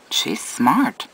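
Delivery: ordinary delivery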